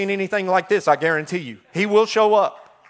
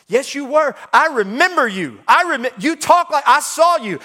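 put-on higher, louder girl voice insisting; insistent little girl; 8 semitones higher, 4 dB louder than usual. Servant girl insisting to Peter, high and loud. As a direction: push back like a stubborn child. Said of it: "the little girl said".

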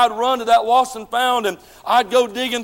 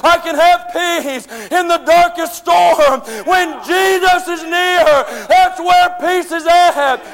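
preaching shout; 3 semitones higher than usual. Real, not acted. Preacher to the congregation about true peace, slow shouting. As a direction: proclaim it slowly.

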